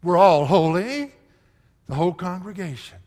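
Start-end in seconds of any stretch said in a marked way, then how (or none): 0.0-1.1 loud, slow rebel's challenge; angry defiance. Retold or imitated. Preacher voicing Korah's rebels defying Moses, loud and slow. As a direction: fling it back at the leaders. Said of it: "the same attitude as all flesh".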